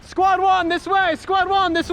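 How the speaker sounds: high shouted call to squad. Real, not acted. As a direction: call your squad over to you. Calling squad in field.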